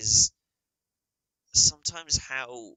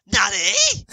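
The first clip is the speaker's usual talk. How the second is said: very high, loud squeal; mocking overdramatic anime reaction, shrieked Japanese what; anime nani; 11 dB louder than usual. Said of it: "Not a".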